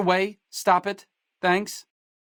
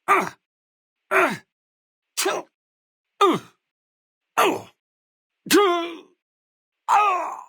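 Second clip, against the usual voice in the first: pained cries; 12 semitones higher, slower than usual. Acted. Combat hits, pained cries.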